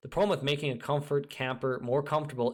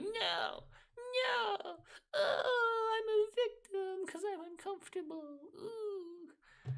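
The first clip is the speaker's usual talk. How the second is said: very high, crying mock whine; whiny fool; 3 dB quieter, much slower than usual. Mocking the fool who won't leave his comfort zone, in a shrill whining cry. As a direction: wail like a self-pitying crybaby. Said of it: "the fool's like".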